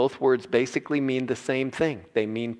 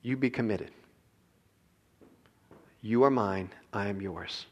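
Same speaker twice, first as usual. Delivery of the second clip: tender; 3 semitones lower, 5 dB quieter than usual. Acted. God's tender covenant words.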